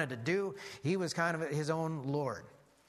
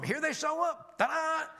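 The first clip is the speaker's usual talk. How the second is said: much higher, showy announcer burst; grand ta-da; 12 semitones higher, 3 dB louder than usual. Acted. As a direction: present them like a magic trick, high and bright. Announcing the magi's grand arrival.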